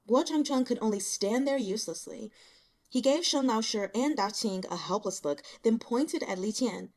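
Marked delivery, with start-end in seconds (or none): none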